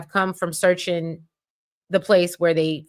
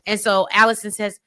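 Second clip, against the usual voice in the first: normal talking voice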